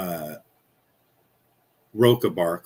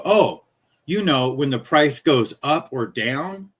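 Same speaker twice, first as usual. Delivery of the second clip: slower, louder, higher, as if to a deaf man; retold raised voice, slow and over-clear; 5 semitones higher, 4 dB louder, slower than usual. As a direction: spell it out loudly for a deaf ear. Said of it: "I just raised my voice".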